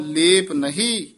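ਲੇਪ ਨਹੀਂ, then a short halt; brief pause after. Shown as a demonstration. Teacher marks the halt after ਲੇਪ ਨਹੀਂ. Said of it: "ਇੱਥੇ ਠਮਕ".

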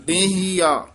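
ਡੇਹੀਆ with a nasal ਡੇ and no nasal on ਹੀ or ਆ; careful pronunciation. Shown as a demonstration. Teacher models the word for students, nasal only on ਡੇ. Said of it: "ਡੇਹੀਆ ਉਚਾਰਨ ਕਰਨਾ ਹੈ".

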